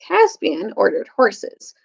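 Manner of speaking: ordinary delivery, nothing marked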